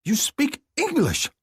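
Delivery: high indignant female voice; furious woman. A narrator's reading. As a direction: hurl it at him in outrage. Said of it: "she bellows in my ear".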